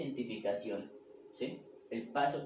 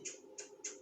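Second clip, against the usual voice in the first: soft voiceless "chu" bursts; whispered little zapping noises; three hushed puffs of sound; imitated quick zaps; 12 dB quieter, faster than usual. Shown as a demonstration. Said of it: "se da cuenta y dice".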